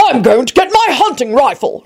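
high-pitched excited shout; shouted announcement. A narrator's reading. Richard sprinting out for his rifle, shouting. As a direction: call it out on the way off. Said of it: "Richard shouted".

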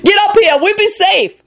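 high, urgent shrieked call. A narrator's reading. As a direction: shriek for him to join you. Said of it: "she shrieked".

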